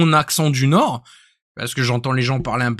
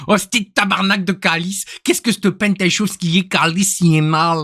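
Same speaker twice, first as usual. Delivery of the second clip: higher, caricatured Québécois swearing; Canadian French accent; 4 semitones higher than usual. Retold or imitated. Streamer relishing quoted Québécois swearing, Canadian French accent. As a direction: rattle off Québécois oaths in mock outrage. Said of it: "J'adore leur accent".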